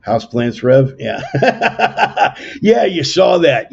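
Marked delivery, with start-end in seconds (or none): 1.3-3.7 big real laugh, voice jumping up loud. Real, not acted. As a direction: laugh it off, caught out by a viewer's comment. Laughs at a viewer's houseplant comment.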